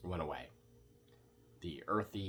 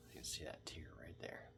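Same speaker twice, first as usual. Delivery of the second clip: soft whisper through tears; 10 dB quieter than usual. Real, not acted. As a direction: hush it out. Eyes tearing from hot pepper.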